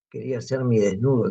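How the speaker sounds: ordinary talk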